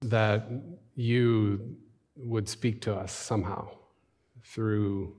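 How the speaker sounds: hushed, low-key prayer with long pauses. Acted. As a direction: pray softly, searching for the words. Leads congregation in prayer.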